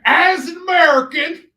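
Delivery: high, loud cartoon American drawl; mock cowboy-style American twang; hammed-up American accent. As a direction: stretch it out as a caricature American, high-pitched. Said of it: "I decided as an Amer".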